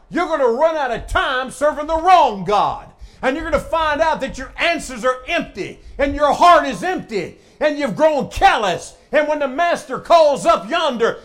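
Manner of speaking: loud, high, hammering preacher's delivery; full-force preaching. Real, not acted. Preacher warning folks about serving the wrong God, full-force preaching. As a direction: drive the warning home to the congregation.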